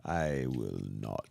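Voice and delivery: very low, slow villain voice; deep slow menacing refusal; evil voice. Acted. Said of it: "You, you're mean".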